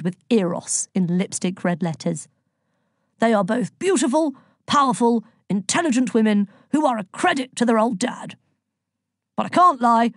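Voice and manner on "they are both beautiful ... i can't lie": higher, emphatic fatherly pride; lightly voiced boastful dad; proud Zeus. A narrator's reading. Zeus praising his daughters to Elliot, proud.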